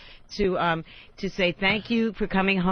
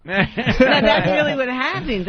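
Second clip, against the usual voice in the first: laughing; 4 semitones higher, 6 dB louder, slower than usual. Real, not acted. Laughing while insisting it's true.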